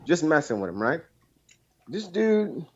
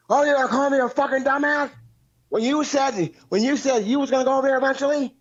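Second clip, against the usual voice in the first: much higher mock rant at a buddy; imitating angry friend; 11 semitones higher than usual. Caller imitating his friend Bullfrog telling him off, high mock rant. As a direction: whine out his friend's furious brush-off, pitched way up.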